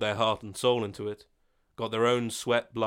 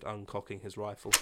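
plain reading voice

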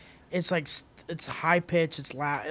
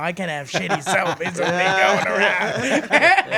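laughing mock complaint; 5 dB louder than usual. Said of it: "and he goes".

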